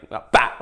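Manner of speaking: "about" burst out and stopped short; exploding. Shown as a demonstration. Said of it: "it's like you're exploding".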